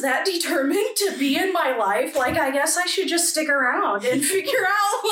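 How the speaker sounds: high, lilting retold realization; retelling an overwhelmed moment with amusement. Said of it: "really overwhelmed".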